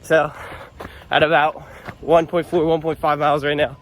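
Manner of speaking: panting mid-run, out of breath. Real, not acted. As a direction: talk while running, short of breath. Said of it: "you can hear I'm kinda out of breath".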